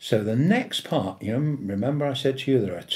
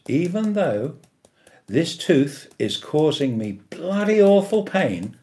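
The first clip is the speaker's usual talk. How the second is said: louder, with weight on "awful"; strong affirmation; 4 semitones higher, 4 dB louder than usual. Shown as a demonstration. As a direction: say it like you mean it. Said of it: "it has to be strong, it has to be powerful".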